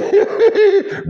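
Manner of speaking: high squealing laugh. Real, not acted. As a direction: burst out in a high giggle. Laughing at himself.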